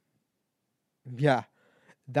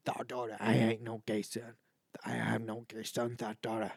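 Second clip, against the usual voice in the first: lower-pitched imitation of a man from a video; viral video man; 4 semitones lower, 4 dB quieter than usual. Host quoting a favourite TikTok video, imitating the man. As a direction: quote the TikTok man, low and deliberate. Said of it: "That man right there on that video".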